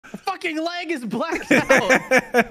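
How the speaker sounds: high, loud laughing words. Real, not acted. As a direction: laugh through the line. Laughing at a teammate's glitch.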